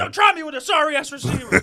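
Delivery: loud, high trash-talk shout; Richard Sherman rant. Retold or imitated. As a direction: yell it like an angry cornerback.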